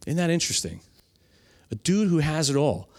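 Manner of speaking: ordinary talk, nothing marked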